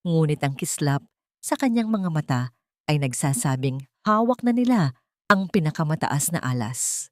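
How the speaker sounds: plain reading voice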